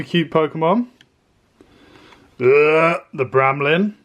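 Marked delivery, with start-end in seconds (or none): 2.4-3.0 loud groan of disgust; disgusted ugh. Real, not acted. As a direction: groan at a bad pull. Disappointed pull of a card.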